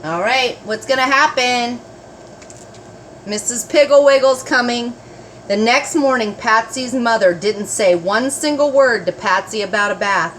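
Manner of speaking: ordinary delivery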